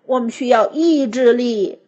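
plain reading voice

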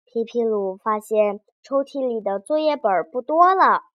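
ordinary delivery, nothing marked

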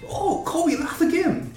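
excited. Retold or imitated. 模仿墨西哥裔海关兴奋.

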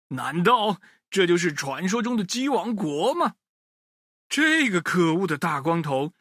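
usual speaking voice

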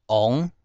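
翁 said "ông", rising tone; Hokkien reading. Shown as a demonstration. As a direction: say 翁 as Hokkien "ong" with no w glide. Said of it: "念成翁".

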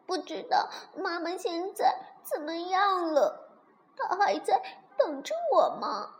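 put-on high, tearful child voice; crying. A narrator's reading. Little dinosaur worrying about his mother, crying. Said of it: "哭着说".

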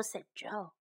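usual speaking voice

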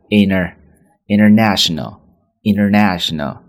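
international said as inner-national, t dropped; American inner-national; flapped international. Shown as a demonstration. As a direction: drop the t in international. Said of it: "这么去读".

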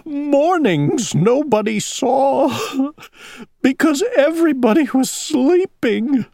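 high, wailing owl with sobbing gasps; hammed-up storybook sobbing. A narrator's reading. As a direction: wail it through gulping sobs. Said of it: "sobbed Owl".

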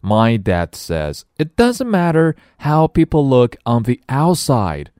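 put-on high, slow, earnest child; high-pitched young heroine; eager little girl. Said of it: "says Nellie".

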